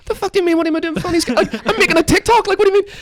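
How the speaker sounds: very high-pitched, incredulous protest; indignant retort. Retold or imitated. Teller defends making a TikTok to the woman, indignant high retort. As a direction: re-enact an outraged comeback, voice way up. Acted retort in the retold quarrel.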